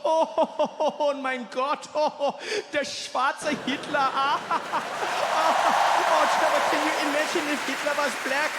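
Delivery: put-on high, loud German-accented guffawing; hammed-up German cackling through the words; killing himself laughing. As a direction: imitate a friend helpless with laughter. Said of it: "he's killing himself".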